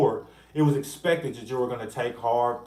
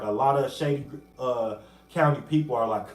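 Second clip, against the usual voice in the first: ordinary delivery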